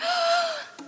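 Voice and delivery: sharp, high frightened gasp. A narrator's reading. Witches seeing the dough swell, gasping in fright. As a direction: suck in air in sudden fright.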